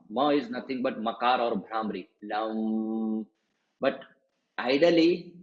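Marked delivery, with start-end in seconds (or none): 2.2-3.3 "Lam" held on a humming m; Lam with closed lips. Shown as a demonstration. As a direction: seal the lips and let the m of "Lam" ring. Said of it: "closing your lip at the Ma sound".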